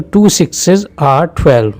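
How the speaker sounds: slow, careful सिक्सेस with its es syllable; teacher modelling two sixes are twelve slowly; slow model sentence. Shown as a demonstration. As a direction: spell it out slowly for students. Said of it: "टू सिक्स are twelve नहीं कहेंगे".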